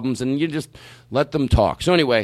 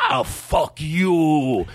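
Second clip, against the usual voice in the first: very drawn-out mock indignation; imitated acquaintance's voice; much slower than usual. Man curses absent customer, drawn-out imitated indignation. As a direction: stretch the curse out in his voice. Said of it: "do him doing that voice".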